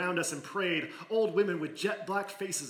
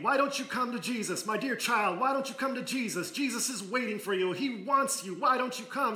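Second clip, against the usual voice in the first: higher, coaxing preacher's plea; pleading minister; 6 semitones higher than usual. Acted. Minister begging the last child to come to Jesus, higher and pleading. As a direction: coax the child like a revival preacher.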